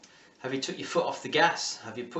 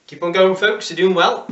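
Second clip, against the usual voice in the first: louder, higher rallying call to the class; loud encouragement; 5 semitones higher, 9 dB louder than usual. Real, not acted. Coach urging the class to keep going, loud and rallying. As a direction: push them through the last seconds.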